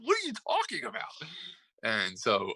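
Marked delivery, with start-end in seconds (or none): 0.0-1.3 high, softer imitated disbelief; mock incredulity. Retelling a coach's baffled reply in the argument, mock incredulity. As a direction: question it with pitch way up, amused. Acts incredulous retort, amused.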